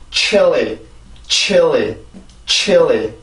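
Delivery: chilly gliding as chi-eo-li; correct pronunciation demo. Shown as a demonstration. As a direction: model chilly carefully, each one falling smoothly. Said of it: "이렇게 해서 발음을 해주시면 돼요". (chilly를 '치얼리'처럼 매끄럽게 발음)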